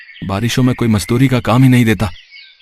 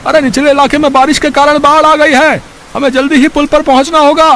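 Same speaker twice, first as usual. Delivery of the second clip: much higher, louder alarmed call; urgent shout; 13 semitones higher, 5 dB louder than usual. Acted. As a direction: yell the flood warning to wake them. Neighbour shouts flood warning. (बाढ़ की चेतावनी ज़ोर से चिल्लाकर)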